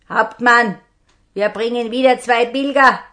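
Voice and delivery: loud, rough bandit calling to his captain; robber's report. Acted. Robber announces new captives to the captain, loudly. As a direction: bark the news up to the boss.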